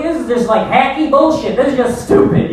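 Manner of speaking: usual speaking voice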